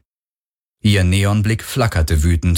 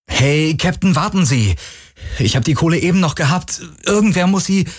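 high, urgent protest; pleading at a raised pitch; frantic pleading; 10 semitones higher than usual. Acted. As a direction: call after the captain in a panic. Desperate man calling captain back.

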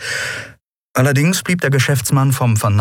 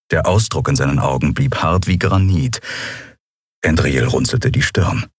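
usual speaking voice

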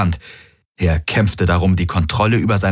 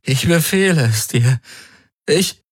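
high, strained order through pain; desperate command; 9 semitones higher than usual. A narrator's reading. Garland desperately ordering the Helix, strained. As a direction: try to command but crack.

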